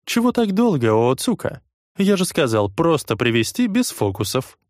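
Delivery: high, pushy boss voice; impatient gang leader. Acted. Noe scolds Ootsuka. (нетерпеливый главарь)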